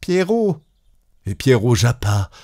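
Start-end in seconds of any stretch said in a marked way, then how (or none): 0.0-0.6 high call down the well. Acted. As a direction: cry out his name down the well. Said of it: "Rose cria".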